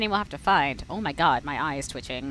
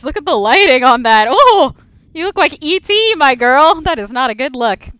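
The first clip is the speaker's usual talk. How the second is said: much higher, loud, teasing; loud mock horror; 7 semitones higher, 15 dB louder than usual. Real, not acted. Streamer teases her own character's lit-up face, loud mock horror. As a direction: shriek at the unflattering face.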